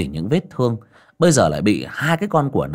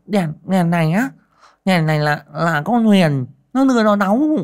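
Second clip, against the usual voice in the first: high, stammering peasant woman; nervous woman explaining the flashlight; flustered Dậu; 9 semitones higher, 4 dB louder than usual. A narrator's reading. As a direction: fumble for an excuse, voice up high.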